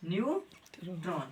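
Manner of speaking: Neutron drawn out slowly and softly; slow drilled word. Shown as a demonstration. Tutor drilling a child on neutron, slowly stretched. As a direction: stretch the word for the pupil to copy. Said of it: "तीसरा को Cauchy बोलेंगे?".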